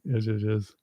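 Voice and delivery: ordinary talk, nothing marked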